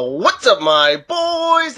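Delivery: loud, rising hype greeting; shouted greeting. Real, not acted. Guest greeting the viewers with a loud hype shout. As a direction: call out to the crowd.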